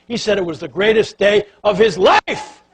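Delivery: loud, high exclamation; boy's proud boast, told loudly; triumphant. Retold or imitated. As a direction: celebrate the big win. Triumphant storytelling peak.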